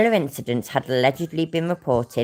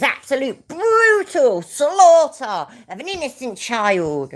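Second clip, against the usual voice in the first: hammed-up dramatic wail, louder and drawn out; mock outrage; 11 semitones higher, 5 dB louder, slower than usual. Streamer parodying how Chile will label it, mock outrage. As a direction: stretch it out in overblown mock horror.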